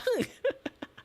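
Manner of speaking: high laugh. Real, not acted.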